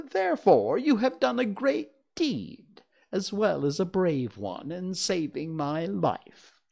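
very high, gracious little queen's voice; high mouse queen. Acted. Mouse queen thanking the Woodman, high gracious squeak. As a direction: speak like a tiny dignified queen.

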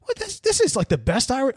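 squeaky high mock disbelief; amazed disbelief. Host hearing an audition, high mock astonishment. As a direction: act stunned by how good it is. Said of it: "and you're like".

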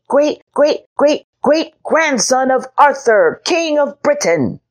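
little knight challenging the sea monster; boyish shout. A narrator's reading. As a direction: bellow the title like a proud child. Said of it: "and shouted".

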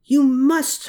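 high, loud retort; yelling back. A narrator's reading. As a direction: yell it back, pitch leaping up. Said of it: "Herman yelled back".

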